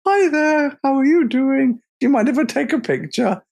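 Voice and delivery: high, chirpy mock greeting to a bear; high friendly greeting. Tourist to a grizzly, high chirpy greeting. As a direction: sweetly greet the huge bear. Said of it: "I'm like".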